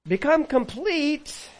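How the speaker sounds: drawn out high and slow; slow, soaring proclamation; proclaimed. Acted. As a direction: ring it out like a proclamation. Preacher proclaiming command.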